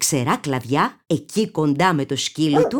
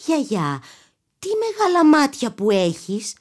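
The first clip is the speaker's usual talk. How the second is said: put-on girl voice; 7 semitones higher than usual. A narrator's reading.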